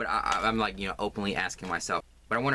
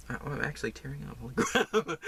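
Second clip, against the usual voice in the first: choked-up, a little surprised; real tears, understated; tearful; 5 semitones higher than usual. Real, not acted.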